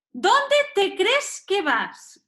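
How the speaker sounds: high, slow, emphatic reprimand; indignant challenge. Acted. Parent stops a child walking off, indignant. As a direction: challenge them with drawn-out indignation.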